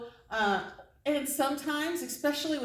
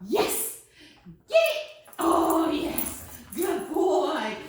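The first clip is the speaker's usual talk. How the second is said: excited cheer; 5 semitones higher, 4 dB louder, much slower than usual. Real, not acted. Dog grabs toy, trainer cheers.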